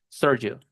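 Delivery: "Sergio" as American SUR-jo; E swallowed. Shown as a demonstration. Teacher repeats gringo version of his name, E swallowed. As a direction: say "Sergio" with an English UR for the E. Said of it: "Ellos se comen la E".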